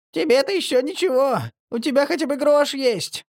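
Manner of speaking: very high tearful whine; whiny. A narrator's reading. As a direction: whimper it out self-pityingly. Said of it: "плаксиво ответил Марли".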